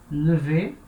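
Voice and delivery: "lever" with a rounded, weak first E, like "euh"; E fechado francês. Shown as a demonstration. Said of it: "então som de E". ("lever" com o primeiro E fraco e arredondado, som de "eu" francês)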